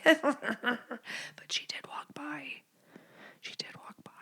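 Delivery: very soft whisper, barely audible; secretive whisper. Real, not acted. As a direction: whisper it so the neighbor can't hear. Confiding that neighbor really passed.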